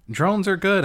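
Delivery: high, loud slogan voice; mock corporate slogan. Quoting the drone company screen, a bright mock slogan. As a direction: sell it like a cheery ad. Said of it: "screens that's saying".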